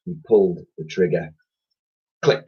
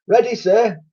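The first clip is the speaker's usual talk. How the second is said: soldier voice; 13 semitones higher, 4 dB louder than usual. A narrator's reading. Titch answers.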